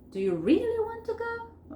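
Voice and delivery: high surprised question, punched "really"; stressed, surprised question. Shown as a demonstration. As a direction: lean on "really" and lift the last part in surprise. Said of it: "Primero acentúo el really".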